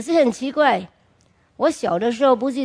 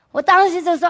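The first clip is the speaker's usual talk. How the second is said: ordinary talk, nothing marked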